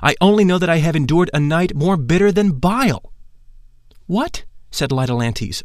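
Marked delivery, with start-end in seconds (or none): 0.0-3.1 higher and louder, incredulous questions; baffled protest, raised voice; bewildered disbelief. Acted. Narrator baffled, questioning Lytolantes. 4.1-4.4 high astonished "What?"; a sharp, high "What?" of shock; astonished gasp of a question. Acted.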